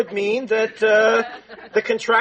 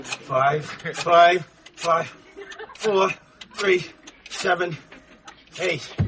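very slow, separated digits, dropping lower; slow, deliberate; 6 semitones lower, 3 dB quieter, much slower than usual. Acted. Husband dialing the lodge number, slow and deliberate. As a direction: spell out each digit with long pauses.

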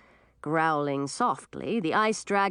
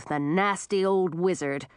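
ordinary delivery

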